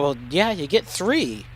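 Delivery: ordinary talk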